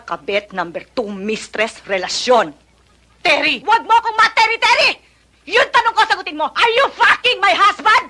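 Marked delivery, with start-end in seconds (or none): 3.2-8.0 high screaming wife; furious shout. Acted. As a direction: scream the accusation. Wife confronts husband's mistress. (galit na sigaw)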